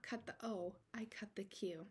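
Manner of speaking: whispered, as if admitting a secret; confessional whisper. Real, not acted. Quilter confessing the cutting mistake to her viewers, whispered. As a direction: drop to a guilty whisper.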